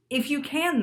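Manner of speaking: ordinary talk, nothing marked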